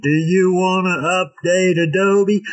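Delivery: slow, high nagging pop-up voice; mock computer prompt. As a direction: imitate an annoying software prompt. Mocking a Windows update prompt.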